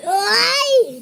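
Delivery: loud high yelp; startled shout; sudden yelp. Real, not acted. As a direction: yelp as you lunge at the camera. Sudden jump toward camera.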